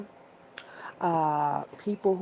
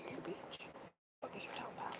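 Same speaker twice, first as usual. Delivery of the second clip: whisper; 20 dB quieter, much faster than usual. Real, not acted. Sassy aside.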